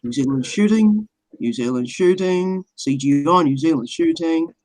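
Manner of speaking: low, droning mock host; Max Egan. Parodying the host's show for friends, low monotone impression. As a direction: impersonate a host intoning his show topics, pitched well down. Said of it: "I'll do the voice".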